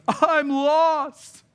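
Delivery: high, loud mock wail; wailing lost boy. Lost boy answers the police officer on the street corner, wailing. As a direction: cry it out like a lost child, high and drawn-out. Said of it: "he was wailing".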